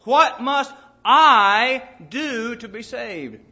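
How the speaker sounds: "I" leaned on, slow and personal; slow it down and press on "I"; slow, personal plea on "I"; stress on I. Shown as a demonstration. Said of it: "It's a personal question".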